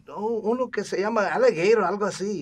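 high, loud imitation of his mother; animated, high-pitched mom impersonation; mother speaking Spanish. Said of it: "in Spanish".

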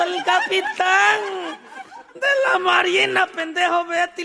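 high, loud, slow shouted warning; shooing shout. Retold or imitated. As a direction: shoo them away with a shout. Owner shooing sellers away.

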